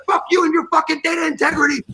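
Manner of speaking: high, angry re-enacted shout; retold screaming back. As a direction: fire back in a mock screaming match. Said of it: "And we're screaming back".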